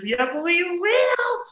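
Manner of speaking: high, loud, drawn-out whoop; rising, stretched, delighted shout; gleeful agreement. Real, not acted. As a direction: sing out a teasing promise. Teasing promise about boxer briefs.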